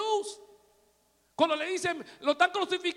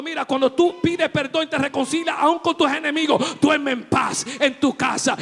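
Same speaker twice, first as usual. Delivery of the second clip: full-force preaching; 6 dB louder than usual. Real, not acted. Preacher shouting to his church, full force. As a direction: preach it out to the congregation.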